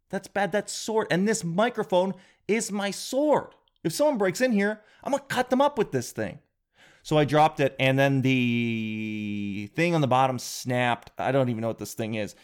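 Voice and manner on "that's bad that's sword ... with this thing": mock excited. Knight sword bit.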